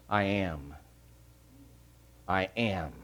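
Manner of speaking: low, hushed declaration; solemn declaration. Acted. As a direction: let the words drop low and soft, weightily. Solemn repeat of divine name.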